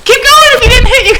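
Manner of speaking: shouting through laughter; laughing. Real, not acted. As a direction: laugh it out loud. Laughing during a burrito game.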